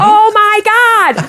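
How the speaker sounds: high, slow "Oh my God!", echoing the crowd back; mock copy of the fans. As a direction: copy the fans' cry, drawn out and high. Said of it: "And I'm like".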